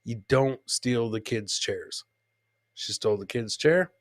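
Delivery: ordinary delivery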